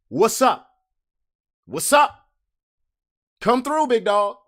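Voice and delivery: cocky taunt. Acted. Taunting the ex, cocky and bright. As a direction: dare them to come.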